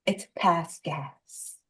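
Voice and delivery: slow, prim and low-voiced; prim disapproving mother. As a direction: correct them slowly, like a prim mother. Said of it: "she's like".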